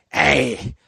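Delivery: slow, booming mock command; imitating scary friend. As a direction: drag it out and roar the last word.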